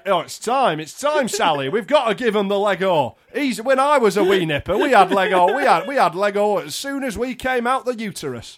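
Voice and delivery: jovial mock dad insisting. Dad telling Sally the boy needs Lego, hammed-up. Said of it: "if this is my dad".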